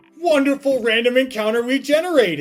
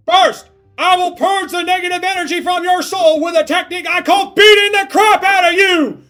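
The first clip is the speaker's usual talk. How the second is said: high, loud barking drill sergeant; 11 semitones higher, 14 dB louder than usual. Acted. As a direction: bark it at a recruit. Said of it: "I gotta do that line of dialogue again".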